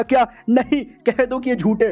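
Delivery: hammed-up gasp of refusal; shocked disbelief. Villain learns the girl will marry the hero, shocked and high.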